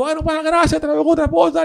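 high, loud mimicry of his sign-off; voz imitada del tipo. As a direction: toss off thanks high and loud as him. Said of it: "El tipo". (imitando al tipo, agudo y fuerte)

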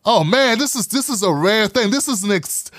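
ordinary talk

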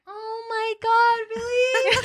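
high, slow, laughing gasp; imitating shocked friend. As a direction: stretch the disbelief high.

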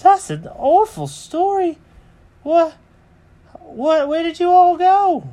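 very high, loud cartoon cat; Jenkins aghast. Cat reacts to the birds' awful story, high loud voice. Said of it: "And Jenkins goes".

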